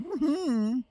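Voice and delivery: high, hummed snooty mm-hmms; mock posh hum, high and soft; posh Lucy impression. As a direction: play a prim posh lady humming approval. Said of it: "all I could think of is Lucy going".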